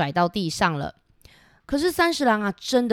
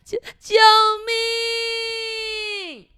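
frightened cry for help; 14 semitones higher, much slower than usual. Acted. Tiny beetle crying for help, high and scared. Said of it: "很紧张的说".

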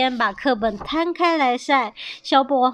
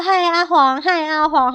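higher, louder hello; cheery greeting; 6 semitones higher, 5 dB louder than usual. A narrator's reading. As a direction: hail a friend in a raised voice. 乌龟们跟阿黄打招呼. (高声打招呼)

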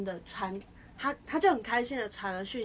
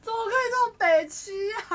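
high, loud self-mocking cry; laughing outburst; 12 semitones higher, 9 dB louder than usual. Real, not acted. Friend cringing at her old self, high laughing cry. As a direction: laugh at yourself.

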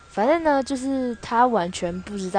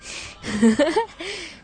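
high, bright burst of laughter; embarrassed giggle; flustered laugh; 10 semitones higher, 4 dB louder than usual. Real, not acted. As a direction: laugh shyly before praising him.

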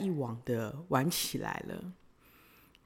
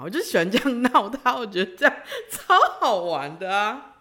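ordinary talk

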